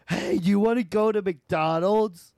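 high, loud mocking impression; mock girl with bad tonsils, loud; loudmouth twin. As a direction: holler it like a loudmouth friend. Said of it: "they're all loudmouth".

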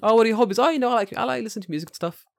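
evasive small talk. Retold or imitated. Coworker dodging questions about his hobbies, mock evasive.

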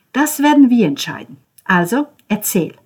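high, loud demand; commanding. Acted. Ben demanding Cesco tell everything, high and loud. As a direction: lay down the law.

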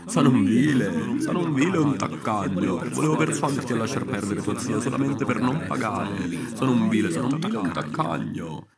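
più alto e più forte, lento all'inizio; si accusa ad alta voce, teatrale; confessione del maresciallo. Acted. (maresciallo che confessa, voce alta e forte)

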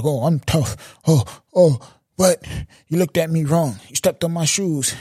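hammed-up street tough act; mock tough guy. Mocking someone trying to be in the street, fake tough. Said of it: "trying to be in the street like".

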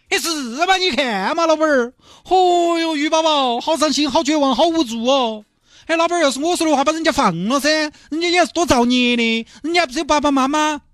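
high, sing-song comic voices haggling over the dolphin; pleading buyer and gruff vendor. Acted. Mr Chen begging the fishmonger to free the dolphin, high hammed-up pleading.

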